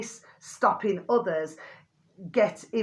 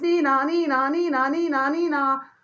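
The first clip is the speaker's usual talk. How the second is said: siren imitation; 7 semitones higher, 5 dB louder than usual. Imitating sirens heard constantly.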